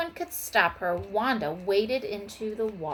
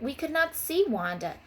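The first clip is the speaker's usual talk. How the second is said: ordinary delivery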